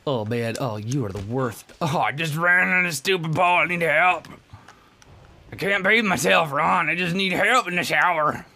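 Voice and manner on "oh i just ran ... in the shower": loud, high hammed-up pleading; mock helpless wail. Gamer begging Ron for shower help, hammed-up wail.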